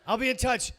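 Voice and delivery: usual speaking voice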